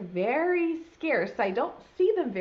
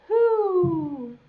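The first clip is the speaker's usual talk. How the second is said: Bear's voice; 8 semitones higher, 8 dB louder than usual. Acted. Bear asks in the story.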